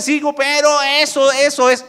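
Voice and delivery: high, loud protesting voice; playing a stubborn believer who won't budge; mock refusal. A believer mocking his own stubborn refusal, loud and high.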